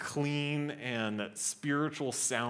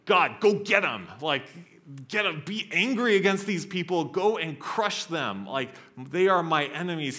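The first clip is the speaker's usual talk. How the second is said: loud, higher-pitched acted demand to God; urgent angry plea; 7 semitones higher, 8 dB louder than usual. Preacher voices David urging God against his enemies, urgent angry plea. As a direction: fire it off as a fervent battle cry. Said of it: "He speaks like this to God".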